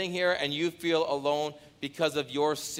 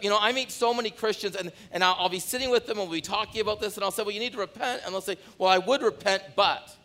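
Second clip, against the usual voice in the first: fired-up preaching; 8 semitones higher, 3 dB louder than usual. Acted. Fired-up sermon storytelling.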